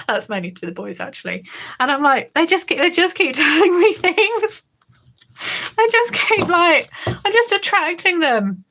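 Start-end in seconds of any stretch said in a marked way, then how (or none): 2.3-8.6 laughing. Real, not acted. Laughing disbelief about pupils.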